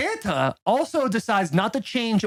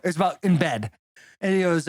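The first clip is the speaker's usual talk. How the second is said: ordinary talk, nothing marked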